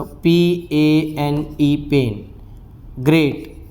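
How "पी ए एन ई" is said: slow spelling. Shown as a demonstration. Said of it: "spelling out letters".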